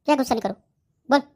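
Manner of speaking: rising irritated challenge; sharp angry repeat. Acted. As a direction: fire the question back. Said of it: "गुस्सा मत कर".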